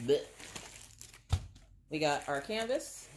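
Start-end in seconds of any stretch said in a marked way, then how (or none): 0.1-0.2 spitting out a hair, blech; sputtering blech of disgust. Real, not acted. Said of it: "I got hair in my mouth".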